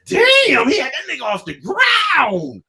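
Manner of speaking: high, loud, slow astonished yell; shocked shout. Retold or imitated. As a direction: shout it like you can't believe your eyes. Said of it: "I said".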